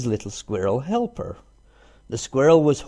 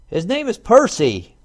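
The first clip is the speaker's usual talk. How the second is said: cried out; 10 semitones higher, 6 dB louder than usual. A narrator's reading. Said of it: "cried the rabbit".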